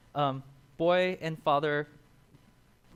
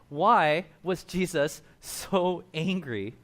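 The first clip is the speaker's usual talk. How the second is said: slow question; 5 dB louder, much slower than usual. Real, not acted. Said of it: "rhetorical emphasis".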